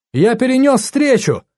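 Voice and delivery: high, urgent reassurance; acted high-pitched call; calling down the phone. A narrator's reading. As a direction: raise the voice to get through a bad line. Friend on failing phone line.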